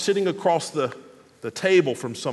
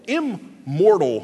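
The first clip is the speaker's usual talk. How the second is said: immortal split slowly, im set apart from mortal; slow, pointed immortal; stress on IM-; slower than usual. Shown as a demonstration. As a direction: break immortal into im, pause, mortal. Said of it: "another body that's not mortal".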